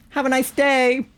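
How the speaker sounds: sarcastic customer service voice. Retold or imitated. Cashier farewell to angry customer.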